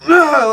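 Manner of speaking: loud, high wordless yell; mock shouting noise. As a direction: imitate an angry man's holler. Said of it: "he's just shouting".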